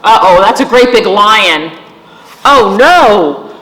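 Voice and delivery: loud, hammed-up dread; storybook panic for kids; mock alarm. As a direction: cry out as if a lion appeared.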